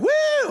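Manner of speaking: very high "woo"; high-pitched mock cheer; imitated party whoop. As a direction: mimic a drunk girl's whoop.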